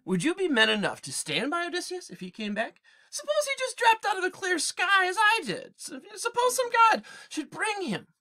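lightly voiced testing stranger; Odysseus sounding out his servants' loyalty; disguised Odysseus. Odysseus speaks to herdsmen.

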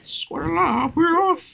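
high, soft cartoon catchphrase; Squatala guy. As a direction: imitate a cartoon character in a small high voice. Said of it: "the Squatala guy".

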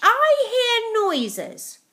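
high, slow screech; screeching grandma. A narrator's reading. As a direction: drag it out in a shrill old screech. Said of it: "Granny screeched".

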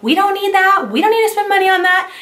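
high-pitched mock complaint; mocking commenters. Vlogger imitating people who comment on her videos, mocking. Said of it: "some people always comment ... they're like".

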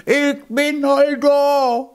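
high, slow, drawn-out cry; spring up and cry out; triumphant shout. Acted. Hedgehog's wife jumps up at the finish and shouts, high and drawn out. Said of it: "auf und schrie".

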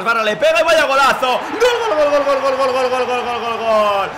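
screaming a long goal call; breakneck goal commentary. Real, not acted. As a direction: roar gol gol gol at the top of your lungs. Goal scored, extended gol call.

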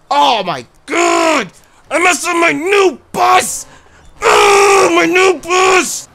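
high, loud wail over the wrecked bus; anguished shout. Real, not acted. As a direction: howl in mock despair. Wrecked his new bus.

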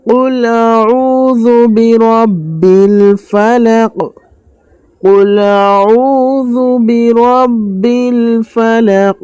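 slow, drawn-out melodic tilawat; Quran recitation. Shown as a demonstration. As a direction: chant it slowly like a qari. Teacher recites the surah. (ধীর সুরেলা তিলাওয়াত)

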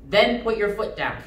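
ordinary delivery

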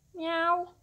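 high-pitched little meow; cat's meow. A narrator's reading. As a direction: answer as a cat. Cat answers with a meow.

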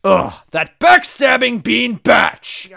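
slow, high, loud insult; full-blown drawn-out angry outburst; furious shout. Acted. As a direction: yell each word in outrage. Second take, angrier and louder.

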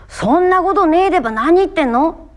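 high, loud Tohoku country retort; Tohoku country dialect. Shown as a demonstration. Actor finding the line's feel through home dialect, spirited Tohoku retort. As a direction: throw it out in earthy Tohoku dialect. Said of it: "みたいなニュアンスかな".

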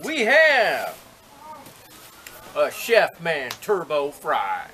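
slow, loud pitchman voice; infomercial. Acted. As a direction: announce it like a TV ad host. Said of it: "That was my infomercial voice".

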